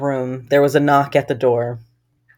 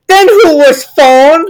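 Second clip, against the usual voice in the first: high, drawn-out mock alarm; mock-horrified punchline; 10 semitones higher, 8 dB louder, slower than usual. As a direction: deliver the twist slowly, pitched way up. Horror story punchline, acted.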